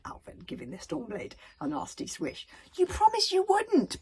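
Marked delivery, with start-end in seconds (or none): 2.8-4.0 high, loud protest. A narrator's reading. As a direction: cry out betrayed. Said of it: "promised Hiccup".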